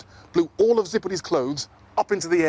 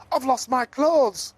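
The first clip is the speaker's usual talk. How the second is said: much higher, slow call; 10 semitones higher, slower than usual. Acted. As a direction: call out for help. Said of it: "called Zippity".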